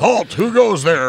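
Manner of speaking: high, loud sentry challenge; alarm shout. Pretend. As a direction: bark it like a night guard.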